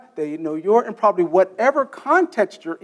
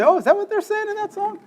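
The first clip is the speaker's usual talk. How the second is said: high-pitched imitation of sudden realization; mock surprise; 14 semitones higher than usual. Teacher imitates students discovering real lyrics, mock surprise. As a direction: squeal it as an astonished listener.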